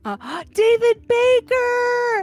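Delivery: excited gasp. Real, not acted. Host spots old coffee regular in chat, excited gasp.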